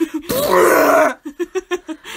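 a big cracking box-opening noise through a laugh; loud mock crack of the rose box; loud imitated snapping noise. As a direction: make the box go snap, hammed up. Imitating the box's loud snap.

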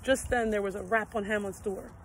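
ordinary delivery, nothing marked

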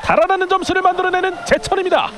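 high, loud shouted goal call; excited goal call. Real, not acted. Live goal call in futsal.

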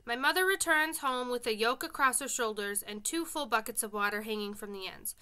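ordinary delivery

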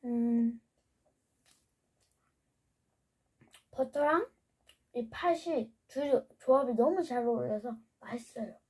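chewing while talking; speaking between bites; mouth full of bread. Real, not acted. Seen 3: Eats bread before and through speech, bites again near 6.6 to 7.7 s.

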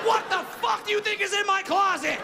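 high, loud, laughing rant; incredulous rant. Real, not acted. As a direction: build the absurd list with rising outrage. Ranting about the elf costume.

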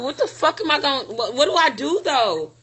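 ordinary talk

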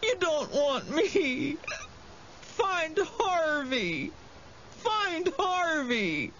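very high, slow, drawn-out wail; tearful whine. A narrator's reading. Wendell begs the monster to find Harvey instead, whining. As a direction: whine miserably through snot and tears. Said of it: "still Wendell whined, all snot and tears".

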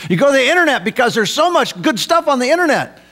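high, quick mock enthusiasm; facetious. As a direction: gush it facetiously. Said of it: "I say that facetiously".

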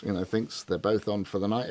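normal talking voice

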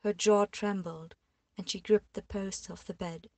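ordinary delivery

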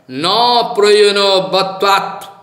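slow, weighty Sanskrit citation; sutra recitation. Acted. Teacher cites a Vedanta sutra to his audience, slow and measured. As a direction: intone the sutra slowly.

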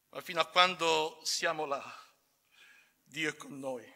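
normal talking voice

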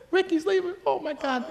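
shrill falsetto mock dismay; shocked Katowski. Mocking Katowski's reaction to Ricky's exit in a high squeal. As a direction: gasp it way up high as a caricature. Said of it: "I called Katowski".

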